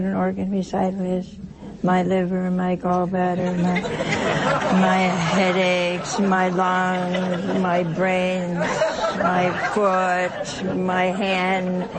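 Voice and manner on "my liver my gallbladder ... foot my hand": lightly droned list of ailments on one note; droning complainer. Acted. Parishioner reeling off ailments to whoever she meets, mildly droning. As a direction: recite each complaint in a level, drawn-out monotone. Acting out a tedious organ recital.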